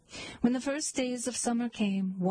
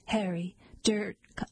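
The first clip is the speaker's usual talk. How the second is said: plain reading voice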